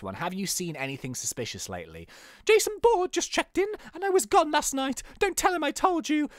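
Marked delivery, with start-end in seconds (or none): 2.4-6.3 very high put-on young informant. Young informant tipping off the detective, high voice. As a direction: squeak out the tip in a high voice. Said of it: "we'll do, like, quite a high voice".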